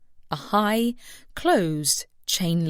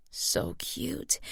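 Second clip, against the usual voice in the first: high breathy whisper of delight; 7 semitones higher, 8 dB quieter than usual. A narrator's reading. Woman charmed by milk bottles, delighted whisper. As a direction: whisper it like you can't believe how cute.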